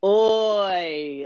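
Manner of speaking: British accent attempt. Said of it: "give me your best British accent".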